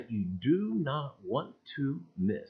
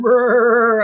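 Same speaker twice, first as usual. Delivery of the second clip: usual speaking voice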